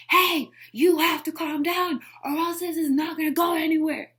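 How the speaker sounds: high, loud demonstrated yell. Shown as a demonstration. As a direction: shout it to shut down an angry person. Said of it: "You gotta yell at them".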